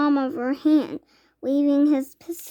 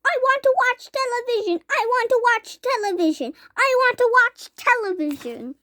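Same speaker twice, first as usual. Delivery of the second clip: high squeaky tantrum; tiny boy's tantrum; tantrum squeak; 9 semitones higher than usual. A narrator's reading. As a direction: whine like a furious tiny boy. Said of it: "he squeaked".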